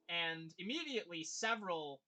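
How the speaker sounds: usual speaking voice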